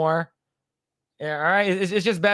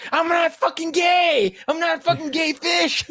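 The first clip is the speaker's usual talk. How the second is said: angry [name]; 11 semitones higher than usual. Retold or imitated. [name] snapping at the fish joke, high furious yell. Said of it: "So he gets really mad".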